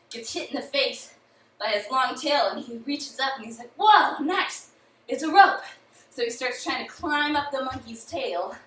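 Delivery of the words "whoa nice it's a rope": high, loud happy surprise; delighted horse thief. Acted. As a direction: exclaim it, thrilled at a lucky find. Thief happily finds a rope.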